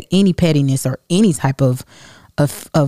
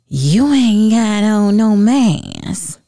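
very slow mock scold; mocking critics; 5 semitones higher, much slower than usual. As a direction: drag out their nagging in a put-on voice. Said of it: "I was getting people saying".

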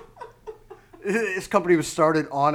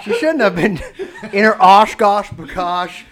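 laughing mock scolding; 3 semitones higher, 9 dB louder than usual. Mock victim-blaming, laughing.

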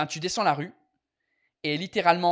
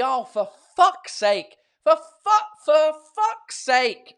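loud, slow, higher exasperated for fuck's sake; hammed-up fed-up groan of a curse; exasperated swearing; 9 semitones higher, 7 dB louder, much slower than usual. Shown as a demonstration. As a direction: drag out each curse in mock frustration. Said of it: "c'est comme en anglais quand je dis".

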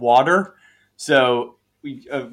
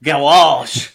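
hammed-up French-style "garage", drawn out; slow, fancy French-sounding garage; mock French; 4 dB louder, much slower than usual. Shown as a demonstration. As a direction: say garage with an exaggerated French lilt. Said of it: "like we're fucking French or something".

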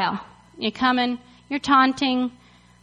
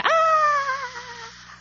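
long high mock scream; hammed-up high wail of panic; panicked fleeing; 12 semitones higher than usual. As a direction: cry out like a routed army.

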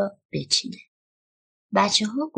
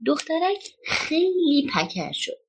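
ordinary delivery, nothing marked

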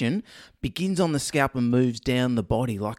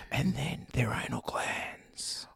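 soft unvoiced whisper; 2 semitones lower, 9 dB quieter, slower than usual. Shown as a demonstration. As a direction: breathe it slowly. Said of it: "put more of an accent into it".

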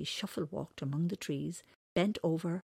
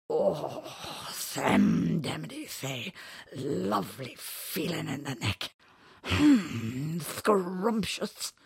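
slow, dreamy creature voice, louder; contented creature voice; 4 dB louder, much slower than usual. Acted. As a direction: savour every word like a happy beast. Whiscockily enjoying drink.

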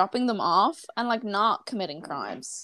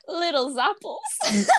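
giggling through the words; can barely get it out for laughing; 4 semitones higher, much slower than usual. Real, not acted. Laughing while telling friends the killer's pet word.

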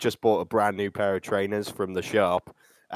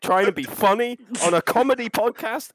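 high-pitched, outraged scolding; indignant telling-off; 10 semitones higher than usual. Pretend. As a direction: scold with rising disbelief. Tells off co-host for joking.

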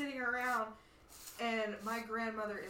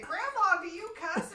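shocked question; 10 semitones higher, 6 dB louder than usual. Retold or imitated. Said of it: "And I said".

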